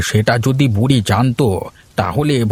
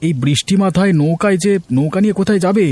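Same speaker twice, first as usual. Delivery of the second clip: put-on much higher, worried young man; young villager calling out; 8 semitones higher than usual. Gopal calls to old Haripada in the rain, high and worried. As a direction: call out across the rain.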